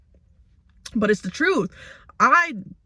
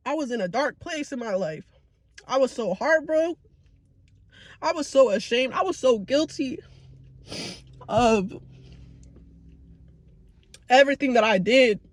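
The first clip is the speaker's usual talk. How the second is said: higher, hushed and hurting; holding back tears; 3 semitones higher than usual. Real, not acted. Vlogger confessing a dark time to viewers, tearful. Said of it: "I got tears rolling down".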